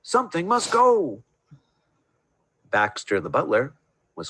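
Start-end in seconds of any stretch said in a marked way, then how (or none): 0.0-1.2 alarmed. Acted. Owner alarmed at tall tree.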